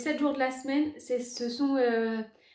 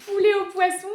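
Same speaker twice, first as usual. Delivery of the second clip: high, loud mocking repeat; amused disbelief; 6 semitones higher, 6 dB louder than usual. Real, not acted. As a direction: repeat it, incredulous.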